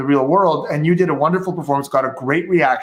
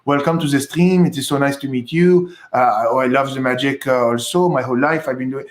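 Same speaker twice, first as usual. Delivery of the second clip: English as spoken by someone from France; French accent in English. Shown as a demonstration. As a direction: put on a Parisian accent in English. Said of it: "Someone from France talking to you would be like".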